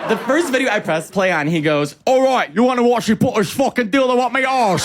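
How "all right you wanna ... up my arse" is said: loud, shouted crude British bloke; rowdy British lad yelling his pitch; British accent. Retold or imitated. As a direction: belt it out in a broad British accent. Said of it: "He's British".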